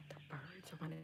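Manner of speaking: quiet. Real, not acted. Hushed to viewers while listening to birds, very soft voice. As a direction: keep it hushed, barely there. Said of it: "I wanna be quiet and tiptoe".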